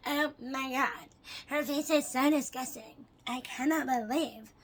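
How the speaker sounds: slow, drawn-out mock horror; dragged-out, hammed-up disgust; mock disgusted commenter.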